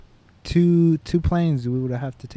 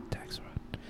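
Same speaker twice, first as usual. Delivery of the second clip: whispered aside; 18 dB quieter than usual. Real, not acted. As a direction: whisper it like a secret. Whispered joke.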